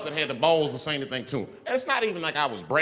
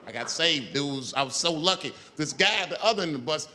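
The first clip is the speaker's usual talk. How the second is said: normal talking voice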